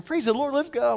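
high-pitched, peppy morning cheer; chipper. Retold or imitated. Colleague picking up the pastor at seven, chipper. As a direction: imitate an annoyingly perky early riser. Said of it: "He's all chipper".

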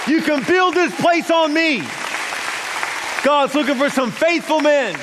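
loud, high, climbing sermon cry; rally the men to their feet, full voice; preaching at full force. Real, not acted.

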